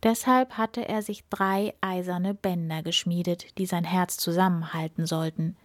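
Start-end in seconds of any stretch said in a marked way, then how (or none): none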